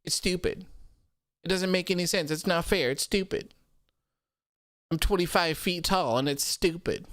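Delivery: petulant crybaby complaint; Braun Strowman nerd voice. Acted. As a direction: pitch it up and whine like a sulking giant. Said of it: "that's my Braun Strowman nerd accent".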